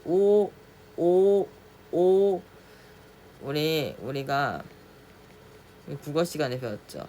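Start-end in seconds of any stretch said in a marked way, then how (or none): 0.0-2.4 오 pitched higher and louder; raised oh. Shown as a demonstration. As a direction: lift 오 upward. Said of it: "위로 올린다고 생각하면 돼요". (위로 올린 오)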